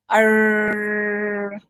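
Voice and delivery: "R" held long with a strong r-colour; drawn-out American R. Shown as a demonstration. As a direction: stretch "R" out for learners to repeat. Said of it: "Say after me or repeat after me".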